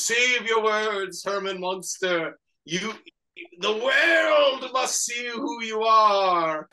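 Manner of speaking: loud, slow, stretched-out mock oratory; grandiose proclamation. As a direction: declaim it like a hammy scientist to the world. Acting scientist's grand speech.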